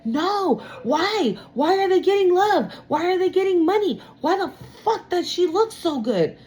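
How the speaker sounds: voicing the envious person's whining complaints; mock jealous rant. Tarot reader voicing jealous person, high whiny indignation.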